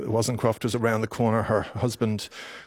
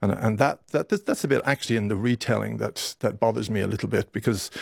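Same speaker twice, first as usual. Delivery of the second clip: normal talking voice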